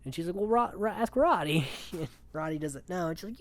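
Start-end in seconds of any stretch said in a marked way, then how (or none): 0.4-1.7 higher and louder, pushing the question back; mock exasperated deflection. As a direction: throw it back at him, louder. Kiara deflects again.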